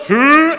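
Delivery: loud high "hoo" alarm noise; high mock alert call; self-mocking hoot. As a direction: hoot at your own cracked voice. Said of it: "make fun of yourself first".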